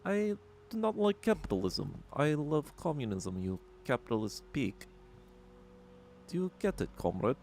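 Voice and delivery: high, hammed-up mock Russian accent. Streamer recalls his childhood Bond-villain bit in a hammy Russian accent. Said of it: "do, like, a Russian accent".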